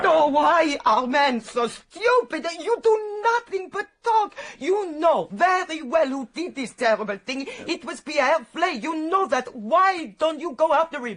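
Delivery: exasperated outburst. Acted. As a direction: scold the men for just talking. Housekeeper berates the detectives.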